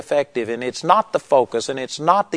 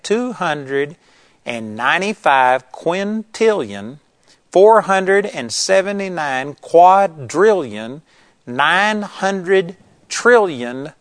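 slow and deliberate; 3 semitones higher, 3 dB louder, slower than usual. Real, not acted. Spelling out a huge number.

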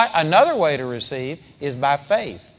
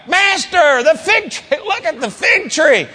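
much higher, astonished exclamation; overwhelmed; 10 semitones higher, 4 dB louder than usual. Shown as a demonstration. Peter shows Jesus the withered tree, astonished. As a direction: gasp it out in shock. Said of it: "he was just overwhelmed".

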